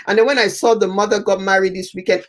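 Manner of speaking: ordinary delivery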